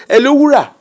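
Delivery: high-pitched mock call from mom; imitating mother calling. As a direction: mimic a mother calling her daughter. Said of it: "she just".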